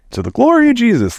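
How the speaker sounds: high, hammed-up churchy exclamation; sing-song mock piety; mock devout praise. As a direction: parody the gushing believer. Mocking devout religious people.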